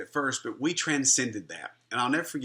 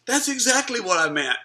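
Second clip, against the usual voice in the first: much higher and louder insistence; emphatic insistence; 11 semitones higher, 6 dB louder than usual. Retold or imitated. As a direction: fire it back, high and loud. Friend insists in retold argument.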